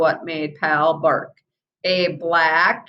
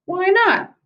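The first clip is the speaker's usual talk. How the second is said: surprised; 13 semitones higher, 3 dB louder than usual. Acted. Bradley asks, surprised.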